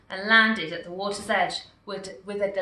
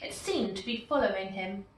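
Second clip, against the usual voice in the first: plain reading voice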